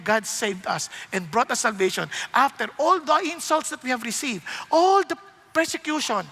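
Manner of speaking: ordinary delivery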